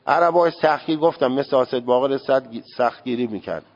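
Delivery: ordinary delivery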